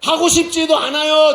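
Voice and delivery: loud, high, exasperated refusal; mock refusal. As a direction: blurt it out as a put-upon listener. Preacher mocks a reluctant listener. (귀찮다는 듯 크게)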